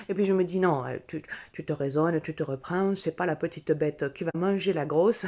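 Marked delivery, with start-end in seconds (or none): none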